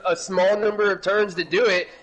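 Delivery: high excited race call; motorsport commentator. Real, not acted. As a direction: build the tension toward the line. Live finish-line play-by-play.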